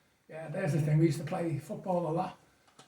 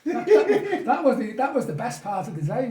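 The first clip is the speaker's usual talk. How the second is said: laughing through the words, higher and louder; bursting with laughter at the memory; 6 semitones higher, 9 dB louder than usual. Real, not acted. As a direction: giggle it out with delight. Laughing while recalling colour telly.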